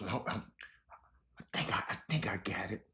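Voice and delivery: whispering to himself while searching; hushed, under his breath, unvoiced. Real, not acted. Host searching for a story on air, whispering to himself.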